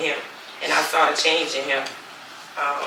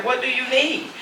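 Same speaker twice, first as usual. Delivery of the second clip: higher-pitched, irritated comeback with rising end; retold irritation; 8 semitones higher than usual. Mother resents being asked where she is, retold irritably. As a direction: push back, pitch climbing on the question. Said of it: "'Cause I don't like that".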